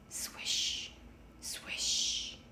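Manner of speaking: soft unvoiced swishing of leaves; whispered swish. A narrator's reading. Leaves landing on the roof, whispered swish. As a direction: breathe out the swish like leaves falling. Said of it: "the leaves fell on the roof".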